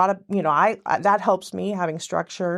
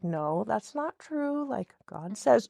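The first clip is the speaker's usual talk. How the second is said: quiet reassurance; 7 dB quieter, slower than usual. Retold or imitated. Nightly self-talk against lies.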